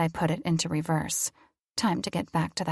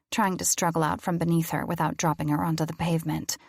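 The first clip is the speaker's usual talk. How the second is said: usual speaking voice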